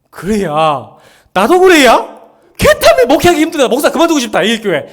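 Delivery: over-the-top me-too venting; exaggerated agreement. Shown as a demonstration. Pastor loudly joining the member's complaints as a bad example. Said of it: "두 번째래".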